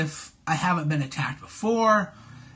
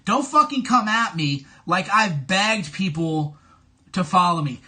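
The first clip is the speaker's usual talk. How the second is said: ordinary talk, nothing marked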